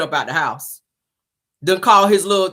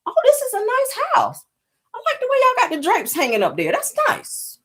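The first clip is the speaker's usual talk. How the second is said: much higher, prissy fake-polite house guest; high mock Portia voice. Mocking Portia admiring Fallon's house in a high voice. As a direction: coo over the drapes in a high put-on voice. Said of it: "That's what Portia was doing".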